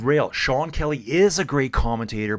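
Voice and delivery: ordinary talk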